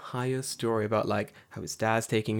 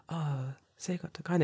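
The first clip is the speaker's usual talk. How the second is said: quiet, polite; 5 semitones higher, 6 dB quieter than usual. Shown as a demonstration. The timid way the kid did not say it, quiet. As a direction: say it politely under your breath. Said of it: "it wasn't like".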